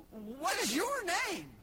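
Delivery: high, slow, stretched-out question; incredulous question. Acted. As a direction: interrogate the parrot in comic disbelief. Burglar incredulously questions parrot.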